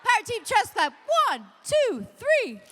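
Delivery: loud, high rallying count; shouted Power Team Trust Clap count; hype call. Real, not acted. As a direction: pump the room up. Hyping the crowd count.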